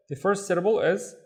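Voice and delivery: usual speaking voice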